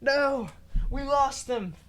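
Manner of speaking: normal talking voice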